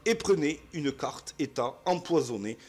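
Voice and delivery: annonce du piège, beaucoup plus fort; narration de jeu. Acted. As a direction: clamer l'échec comme un maître de jeu. Reads trap card dramatically. (annoncer le piège aux joueurs, d'une voix forte)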